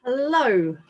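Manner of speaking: ordinary delivery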